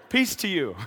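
light greeting breaking into a chuckle; amused greeting. Acted. Jesus greeting the screaming disciples afterwards, amused. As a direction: greet them, amused at the fright. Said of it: "then I think he said".

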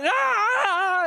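high, loud cry of joy as he tackles his son; joyful shout. Acted. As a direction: burst out with a happy yell. Father runs to tackle son.

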